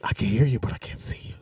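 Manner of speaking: whispered through a wall; whispering. Pretend. Acting dater behind wall.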